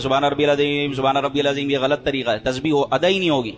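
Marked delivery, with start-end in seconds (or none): 0.0-1.7 سبحان ربی العظیم gabbled quickly, ع swallowed; hurried mumbled tasbih; rushed wrong way. Shown as a demonstration. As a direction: rattle it off hastily as the careless do. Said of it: "بعض لوگ جلدی جلدی پڑھیں".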